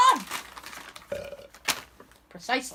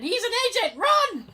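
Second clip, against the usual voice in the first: high alarmed warning cry; panicked shout; 9 semitones higher than usual. Retold or imitated. Someone shouted in theater.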